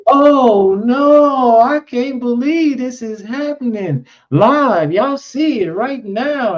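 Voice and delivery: high, drawn-out wail of disbelief; slow, high-pitched horrified lament; horrified news lady. Retold or imitated. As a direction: imitate a distraught live TV reporter. Imitates horrified newscaster live on TV.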